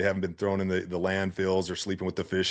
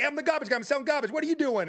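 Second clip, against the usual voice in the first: very high, hammed-up yell right back; mirroring salesman; faster than usual. Salesman yelling back at a rude Philly homeowner, hammed-up. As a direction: shout back just as rude as you got. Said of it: "And you're like".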